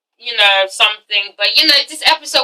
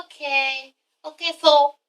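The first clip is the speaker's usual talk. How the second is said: soft, slow, drawn-out okay; meek giving in; 7 dB quieter, slower than usual. Retold or imitated. Amina meekly giving in to Peter, soft and slow. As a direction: cave in softly and slowly. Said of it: "she's like".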